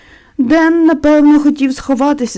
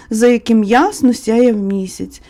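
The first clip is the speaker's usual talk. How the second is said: ordinary delivery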